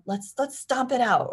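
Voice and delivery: put-on rally the child with energy; upbeat invitation. Shown as a demonstration. Parent offering child a stomping outlet, upbeat. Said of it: "Or like".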